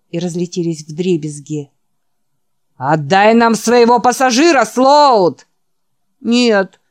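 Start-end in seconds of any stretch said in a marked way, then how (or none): 2.8-5.5 громкий высокий выкрик-требование; выкрикни угрозу толпы с улицы; требовательный крик. Acted. Troll outside yells demand. 6.2-6.8 groaned protest, louder and higher; стон. Acted. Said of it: "простонал Ричард".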